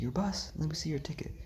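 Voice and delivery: high, demanding guard voice; security guard. Retold or imitated. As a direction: question him like a suspicious guard. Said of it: "He's like".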